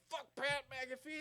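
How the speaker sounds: high, loud, slow defiant quote; mock defiant wrestler promo; defiant Cody. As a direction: spit it out at a rival. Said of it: "and he's just like".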